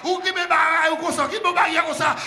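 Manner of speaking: loud, high shouted preaching; preaching at full force. Real, not acted. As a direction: drive the message home to the church. (prêche à pleine voix, criée)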